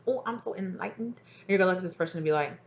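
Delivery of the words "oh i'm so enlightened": high, soft holier-than-thou voice; mock pious nun. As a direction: imitate a monk or nun, softly and sweetly pretentious. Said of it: "like, a monk, a nun, like".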